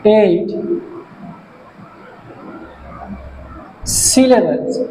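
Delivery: one word at a time, long pauses between; word-by-word dictation. Shown as a demonstration. Teacher dictating a definition for pupils to write, word-by-word dictation. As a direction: dictate very slowly, each word standing alone.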